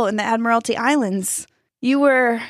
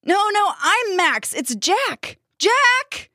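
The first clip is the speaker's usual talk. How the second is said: yelled correction; 14 semitones higher, much slower than usual. A narrator's reading. Max correcting the chanting heads, urgent yell. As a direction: yell the right name, pitch way up. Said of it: "Max yelled".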